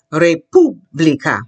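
"República" slowly with a rolled R; slow modelling. Shown as a demonstration. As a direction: break "República" into re-POO-blee-ka, stressing POO. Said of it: "I'll take it slowly".

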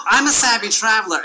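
ordinary talk

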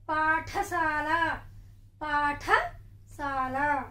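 పాఠశాల dragged out very slowly, twice; very slow teacher model; slow repeated word. Shown as a demonstration. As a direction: pronounce పాఠశాల syllable by syllable. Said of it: "మన పాఠశాల".

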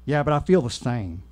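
unconvinced, lightly Southern voice; vague Southern accent. Acted. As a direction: object with a light Southern twang. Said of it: "a vaguely southern accent".

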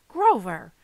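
sharp, loud reprimand; stern, forceful rebuke; scolding. Acted. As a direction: cut him off with his name. Mother scolds Grover.

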